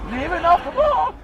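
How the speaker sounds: high quick imitation.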